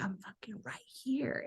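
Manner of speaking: slow hissed whisper; whispered retort. Retold or imitated. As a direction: breathe it out in a whisper, pointed. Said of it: "And she was so loud".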